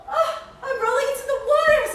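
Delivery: high, loud joyful whale; happy whale voice. Acted. Boris rolling back into the water, high joyful cry. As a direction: roll in with glee as the whale. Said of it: "says Boris".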